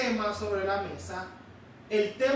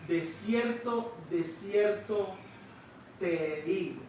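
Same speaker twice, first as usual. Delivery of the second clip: slow, weighty, each word laid down; slow solemn quote; slower than usual. Acted. Preacher quoting Jesus to the congregation, slow and solemn. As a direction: intone it like a sacred formula.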